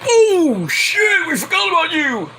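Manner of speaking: high-pitched imitation of startled fans; fans' surprise. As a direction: squeal it as a crowd suddenly remembering. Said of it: "The fans are like".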